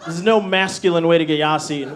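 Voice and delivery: ordinary talk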